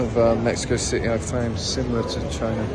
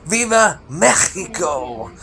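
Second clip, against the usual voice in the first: mock presidential cry; 7 semitones higher, 7 dB louder, slower than usual. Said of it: "the president goes and... goes".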